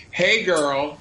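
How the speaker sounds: high, slow mock sassy greeting; hammed-up hey girl. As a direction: camp it up, drawn out and high. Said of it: "I'm not walking around going".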